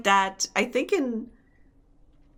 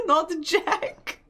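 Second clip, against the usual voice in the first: correction broken by laughter; laughing correction; 7 semitones higher than usual. Pretend. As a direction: giggle through the correction. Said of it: "um actually".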